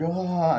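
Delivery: high "ah" of fleeing victims; mock scream. People running from the chainsaw, mock scream.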